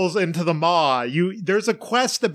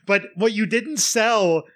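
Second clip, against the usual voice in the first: normal talking voice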